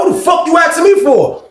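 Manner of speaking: high indignant yell; retold angry yell. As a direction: shout it back at him, fed up. Repeats the yell.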